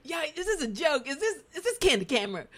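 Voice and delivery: high, laughing incredulous questions; mock disbelief. Host voices viewers reacting to the mix-up, mock incredulous. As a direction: protest as if being pranked.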